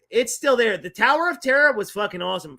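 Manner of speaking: usual speaking voice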